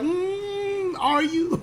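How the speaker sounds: high, drawn-out doubting "Mm, are you?"; skeptical doubt. Retold or imitated. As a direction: pitch it up as if you don't buy it. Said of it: "and you're like".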